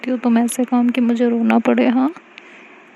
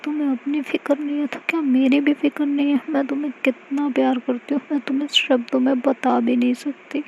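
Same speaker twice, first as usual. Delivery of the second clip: crying while talking; 4 semitones higher than usual. Acted. Mahi to hurt Aashi, tearful reproach. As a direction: scold her through tears, mildly. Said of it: "रोने लगती है और कहती है".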